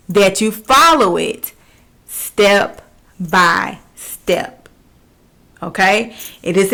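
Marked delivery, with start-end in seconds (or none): 2.1-4.7 very slow step by step. Shown as a demonstration. Teacher stresses following instructions.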